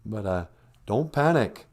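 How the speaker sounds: usual speaking voice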